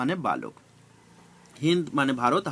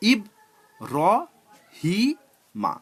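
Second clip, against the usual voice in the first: "ইব র হি মা" with a pause after each syllable; four separate syllables; 3 semitones higher, slower than usual. Shown as a demonstration. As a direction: say "ইব র হি মা" one syllable at a time. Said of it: "চারটে syllable ভাগ করে আমরা ইব র হি মা পড়বো".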